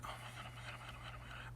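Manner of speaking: rapid-fire voiceless muttering; hushed, barely audible despair; exasperated whisper. Retold or imitated. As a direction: whisper it over and over to yourself. Silent exasperation during the ride.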